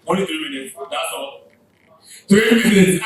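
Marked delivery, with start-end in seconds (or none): 2.3-3.0 booming call-out to the room; host calling out the time loudly. Real, not acted. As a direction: announce the limit loudly.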